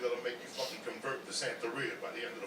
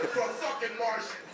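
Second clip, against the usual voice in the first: laughing rant; 11 semitones higher, 16 dB louder than usual. Real, not acted. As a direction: rant at them while cracking up. Comedian ranting about Scientology.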